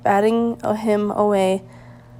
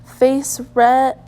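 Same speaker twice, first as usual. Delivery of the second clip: plain reading voice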